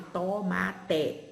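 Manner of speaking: "tomate" dictated as "tô-má-té", every vowel as written; spelling pronunciation. Shown as a demonstration. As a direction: dictate "tomate" with full o and hard "té". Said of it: "tem professor que às vezes dita". ("tomate" ditado como se escreve, "tô-má-té")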